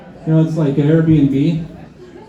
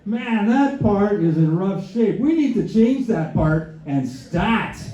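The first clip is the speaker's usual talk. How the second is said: higher, slow, hammed-up wonder; mock gasping at a car part; mock amazement; 6 semitones higher, much slower than usual. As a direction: overplay being impressed by the part. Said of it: "I'm like".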